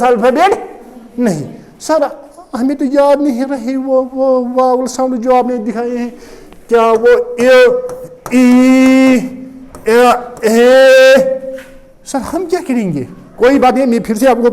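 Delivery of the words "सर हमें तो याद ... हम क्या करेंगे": unsure student groping for the vowel sounds; imitating a student, hesitant and stretching the vowels; forgetful student. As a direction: play a lost pupil appealing to sir. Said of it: "अब आप कहेंगे sir".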